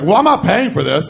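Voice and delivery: high, loud mock indignation; real indignant. Customer refusing to pay for the delivery, indignant. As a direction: imitate an affronted customer refusing loudly. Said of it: "he was, like, real indignant".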